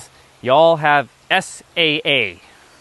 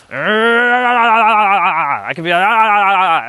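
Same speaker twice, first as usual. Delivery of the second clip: long, high frustrated growl-yell; hammed-up roar of being upset; drawn-out mock scream of anger; mock mad yell; 5 semitones higher, 4 dB louder, much slower than usual. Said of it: "perpetually upset and be mad right now".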